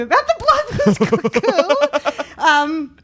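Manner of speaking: high, loud, breaking into laughter; laughing. Real, not acted. Journalist recalling the question she asked Jimmy Carter, laughing. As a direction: laugh through the words, pitch shooting up.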